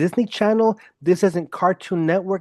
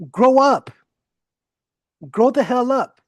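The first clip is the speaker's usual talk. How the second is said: fed-up shout; 7 semitones higher, 3 dB louder than usual. Real, not acted. Scolds critics of his language.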